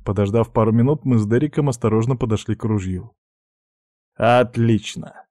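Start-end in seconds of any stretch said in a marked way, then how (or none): 4.1-5.2 slow, drawn-out satisfied exclamation; pleased. Acted. Pirate glad the rifle did not fire, pleased. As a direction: savour it slowly, delighted. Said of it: "обрадовался Дерек".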